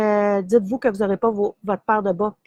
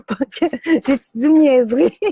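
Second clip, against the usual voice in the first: en riant; 4 semitones higher, 4 dB louder than usual. Real, not acted. Rit en parlant de Frédéric.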